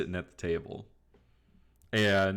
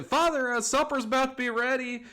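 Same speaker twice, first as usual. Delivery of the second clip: put-on high, loud girl's holler; calling out; 4 dB louder, faster than usual. Daughter tells father supper's ready, high calling voice. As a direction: call it through the house in a high voice. Daughter calls from inside farmhouse.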